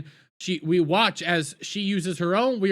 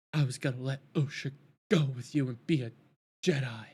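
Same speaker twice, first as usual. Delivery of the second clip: softer, fading last words of a dying mother; a hint of a dying witch mother; 6 semitones lower, 6 dB quieter than usual. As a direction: breathe it out like her last words. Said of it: "as she dies".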